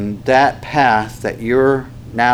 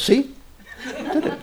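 much higher, laughing triumph; karate teacher; 5 dB quieter, slower than usual. Retold or imitated. Instructor gloating after sitting on the student, high and laughing. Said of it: "And he goes".

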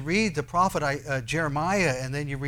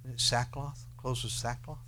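ordinary talk, nothing marked

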